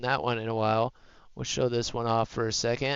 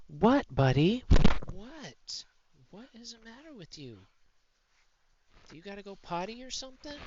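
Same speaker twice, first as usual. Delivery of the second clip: pet talk; 8 semitones higher than usual. Real, not acted. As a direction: coo a worried question at the puppy. Asking the whining dog.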